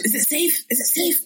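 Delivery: whisper-menace like the Nazi dentist; Olivier impression. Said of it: "Yeah, pretty much".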